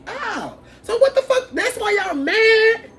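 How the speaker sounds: high, loud, slow mocking drawl; mocking realization. Realizing why the rivals are mad, high slow mocking. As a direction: stretch it out in gleeful mockery. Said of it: "I'm like".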